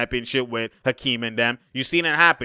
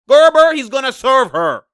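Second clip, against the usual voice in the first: loud high call; shouting at the screen; 10 semitones higher, 8 dB louder than usual. Real, not acted. Fan shouting at the screen during the game, loud call. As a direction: yell out the warning.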